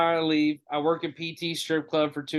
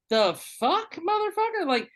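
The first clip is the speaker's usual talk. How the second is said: high-pitched, incredulous retold outrage; indignant, squeaky-high refusal; outraged reaction; 9 semitones higher than usual. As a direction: protest it in disbelief, voice leaping up. Acting out angry refusal to boss.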